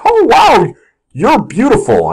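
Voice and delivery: high gushing 'oh wow', then slowing; mock admiring gush; put-on flattery. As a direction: pay an over-acted compliment. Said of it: "So I'll be like".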